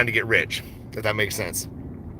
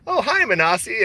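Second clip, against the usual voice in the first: high-pitched mock greeting from an old lady; imitated elderly lady; 11 semitones higher than usual. Elderly lady misreading his name online, high imitated greeting. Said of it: "a fewer elderly ladies".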